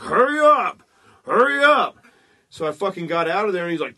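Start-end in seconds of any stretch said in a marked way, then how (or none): 0.0-2.0 impatient shout, high and loud; old man yelling. Retold or imitated. Old man at the porta potty door yells hurry up. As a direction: bang on the door and yell at him to hurry.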